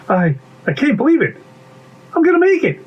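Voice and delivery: high-pitched, urgent story voice; excited striving. Acted. Story character cheering themself on to make it, excited. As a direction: push upward with rising hope.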